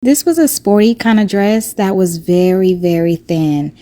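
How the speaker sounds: loud outdoor voice over the wind; shouting over wind. Real, not acted. Trying to talk my loudest over wind.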